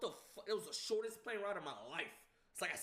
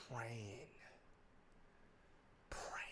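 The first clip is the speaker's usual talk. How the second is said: softer hushed whisper, barely voiced; whispered prayer; 8 semitones lower, 11 dB quieter than usual. Reliving waiting for the result, hushed praying whisper. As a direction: breathe it like a desperate prayer. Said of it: "I was praying".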